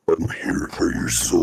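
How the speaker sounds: low demonic voice changer. Pretend. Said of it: "a little demonic there".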